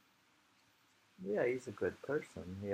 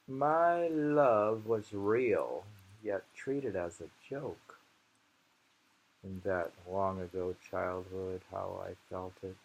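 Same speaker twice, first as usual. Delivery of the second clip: poem reading; 4 dB louder than usual. Acted. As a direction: give each line weight, as a reading. Reads a poem aloud.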